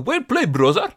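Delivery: high, loud mock Balkan accent; Balkan accent imitation. Imitating the villain's Balkan accent in Day Shift. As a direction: ham up a thick Balkan accent. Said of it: "il te sort un accent pas possible".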